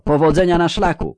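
high, loud send-off; shouted farewell. A narrator's reading. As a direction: shout good luck down the road. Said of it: "krzyknęły unisono".

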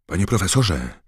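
Harry, calling out. A narrator's reading. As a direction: call to get the professor's attention. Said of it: "zawołał Harry".

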